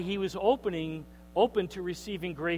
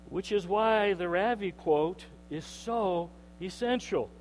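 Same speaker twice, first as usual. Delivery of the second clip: ordinary talk, nothing marked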